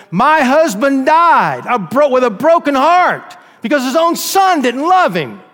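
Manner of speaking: high, loud, anguished lament; grieving outcry. Acted. Mother tells the court of her dead husband, high and loud. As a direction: let the grief burst out. Mother testifies, grief rising.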